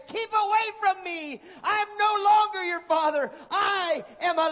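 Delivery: high, loud anguished yell; the leper yelling at his running children. A narrator's reading. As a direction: yell to keep your children away. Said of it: "yell at them and say".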